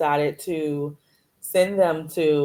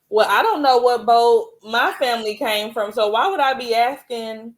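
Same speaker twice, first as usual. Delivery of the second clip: higher-pitched, mildly exasperated comeback; indignant retort; 6 semitones higher than usual. Retold or imitated. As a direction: throw the question back at the asker, pitch raised. Retold retort to nosy questioner.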